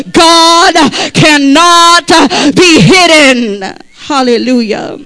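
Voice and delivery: drawn-out shouted proclamation; slow preaching shout. Acted. Preacher proclaiming to the congregation, slow and loud. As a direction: stretch each word and let it ring.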